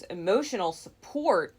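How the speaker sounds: loud scoffing echo of the words; indignant. Retold or imitated. Jealous partner mocking his excuse, loud and indignant. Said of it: "she got all in-, indignant".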